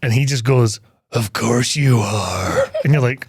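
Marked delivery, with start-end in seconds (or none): 1.1-2.7 very slow, low, creepy villain voice; creepy Kurgan. Retold or imitated. Kurgan answers Candy the prostitute, slow creepy menace. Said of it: "All right, creepy Kurgan".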